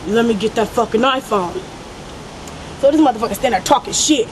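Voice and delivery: usual speaking voice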